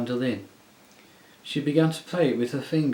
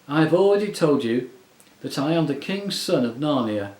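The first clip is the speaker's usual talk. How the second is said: louder, stern and regal; 5 semitones higher, 7 dB louder than usual. A narrator's reading. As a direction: state it like a firm prince. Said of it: "said the prince sternly".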